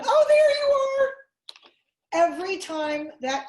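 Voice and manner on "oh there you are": very high, loud, delighted squeal; gleeful peekaboo reveal. Shown as a demonstration. Lecturer finding the vanished people in peekaboo, exaggerated delight. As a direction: burst out as if they just reappeared.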